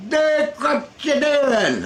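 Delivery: high, slow yelled call across a field; Rusty yelling. Retold or imitated. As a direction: holler from the road. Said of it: "and he yells".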